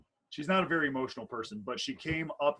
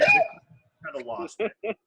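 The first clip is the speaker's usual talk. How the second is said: laughing; 12 semitones higher, 10 dB louder, slower than usual. Real, not acted. Laughing while telling wedding story.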